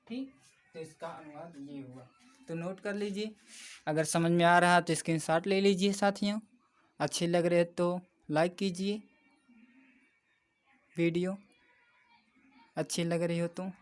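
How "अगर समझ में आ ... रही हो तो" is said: much louder plea to like the video; loud appeal. Real, not acted. Teacher loudly urging viewers to screenshot and like. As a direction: push the viewers to hit like.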